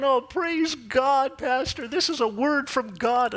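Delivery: high, slow, elated praise; mock-joyful family praising. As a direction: gush with relief and joy. Family rejoicing, high excited voices.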